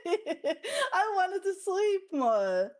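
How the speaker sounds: high, drawn-out plea through a laugh; playful protest. Real, not acted. Playful self-defence after being teased.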